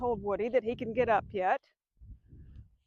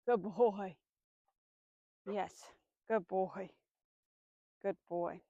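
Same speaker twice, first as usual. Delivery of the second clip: softer, lower, slow praise for the dog; 6 semitones lower, 10 dB quieter, slower than usual. Real, not acted. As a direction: murmur it gently to the dog.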